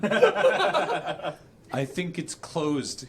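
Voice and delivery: slow words broken by laughter; can barely get it out for giggling; laughing through it. Acted. Reading Ron's line, cracking up mid-word. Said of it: "he noticed".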